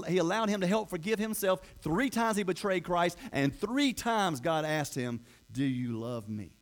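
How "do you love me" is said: low, slow, soft asking; tender question. Acted. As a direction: ask it gently, taking your time. Jesus asks Peter, tender climax.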